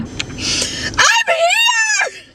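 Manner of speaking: tearful and high, voice wobbling with joy; crying from sheer excitement; happy crying. Real, not acted. Fan arriving to see her favorite author, tearfully overjoyed. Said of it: "Like, I'm actually crying".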